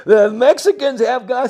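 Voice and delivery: half-mocking panicked yell; mock scream. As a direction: ham up their panicked shouting. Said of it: "they started screaming again".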